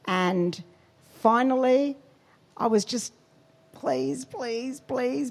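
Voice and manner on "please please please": high-pitched desperate plea, acted out; imitated begging; retold begging. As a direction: plead over and over, higher than usual.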